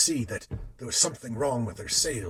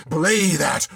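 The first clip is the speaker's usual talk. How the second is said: loud, higher roar; 5 semitones higher, 7 dB louder than usual. A narrator's reading. Captain overruling the sailor, a roar. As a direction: roar to cancel the order. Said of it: "roared the captain".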